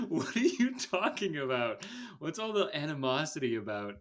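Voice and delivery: high, loud, incredulous, laughing through it; baffled disbelief. Retold or imitated. Podcaster mocking a line, incredulous and laughing. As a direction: react in disbelief to a ridiculous insult. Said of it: "And I was like".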